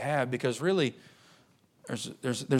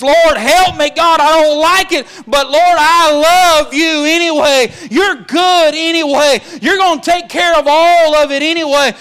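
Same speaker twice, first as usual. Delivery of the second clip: anguished groaning prayer; 18 dB louder than usual. Acted. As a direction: wail it up to God, hurting but trusting. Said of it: "Groaning is".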